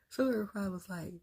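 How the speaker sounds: ordinary talk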